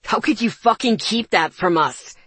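loud, hurt and furious; pained anger. Acted. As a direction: hurl it at her in pain. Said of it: "His voice was full of pain".

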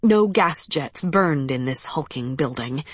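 ordinary delivery

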